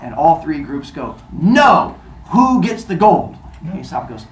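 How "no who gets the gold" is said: loud, high, drawn-out refusal; mock outrage, voice raised and pitched up; acted group protest. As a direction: protest like an indignant crowd. Three groups refuse Aesop's proposal together.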